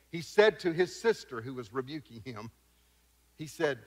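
usual speaking voice

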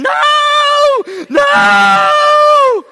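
high, loud mock child's scream; imitating a screaming child. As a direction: shriek it like a kid being carried off. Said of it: "he was screaming".

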